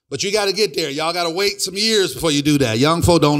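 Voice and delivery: ordinary talk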